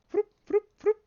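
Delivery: a playful, high, loud revving engine noise; mock engine vroom. Gamer on a winning roll, high loud engine revving noise. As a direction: rev it up like a toy car. Imitating a car while winning.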